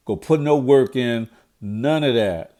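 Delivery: ordinary talk, nothing marked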